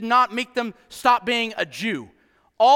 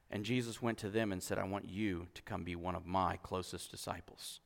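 much softer and lower, intimate; hushed; 11 semitones lower, 14 dB quieter than usual. Real, not acted. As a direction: draw them in with a hush. Preacher drops voice to a hush.

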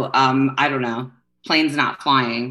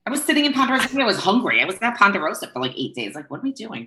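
exasperated; 8 semitones higher than usual. Retold or imitated. Exasperated at own eating footage.

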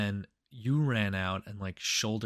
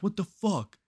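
startled guy; 10 semitones higher than usual. Retold or imitated. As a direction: react like the first hit caught him off guard. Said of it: "he was like".

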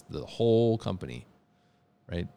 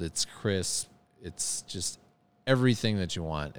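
usual speaking voice

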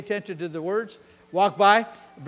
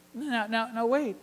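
ordinary talk, nothing marked

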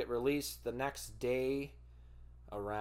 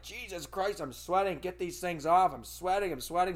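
higher, urgent, repeated pleading; agitated complaining; 7 semitones higher, 4 dB louder than usual. Retold or imitated. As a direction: beg them to take the blankets off. Overheated under blankets, pleading.

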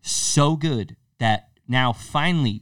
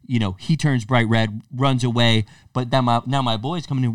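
ordinary delivery

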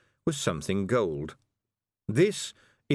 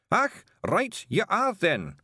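high, loud Scots; Feegle in disguise; 11 semitones higher, 3 dB louder, slower than usual. Acted. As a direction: wee man pretending to be a man. Bearded man.

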